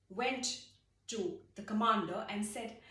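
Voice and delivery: ordinary delivery, nothing marked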